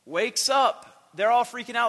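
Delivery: normal talking voice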